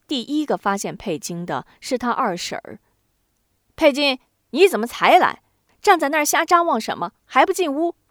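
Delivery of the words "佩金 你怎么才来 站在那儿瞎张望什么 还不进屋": 又高又响地催问; 冲着门口连声招呼责问; 大声责问. A narrator's reading. 二婶儿冲刚进门的佩金喊. (高声催促)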